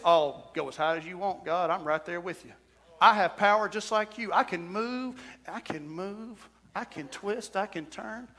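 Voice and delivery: hushed, sly devil mocking God; a scheming devil whispering his boast; taunting devil voice.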